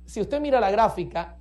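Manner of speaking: ordinary talk, nothing marked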